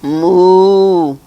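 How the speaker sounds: loud, long moo; cow's moo. A narrator's reading. Duck making the cow's noise, loud moo. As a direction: moo it out big and round. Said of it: "said the duck".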